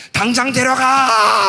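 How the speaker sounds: a high, slow, drawn-out wail of a demand; anguished shouted prayer, high and loud; desperate crying yell. Retold or imitated. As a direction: cry it out to God at the top of your voice, stretching every word. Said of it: "하면서 막 울고".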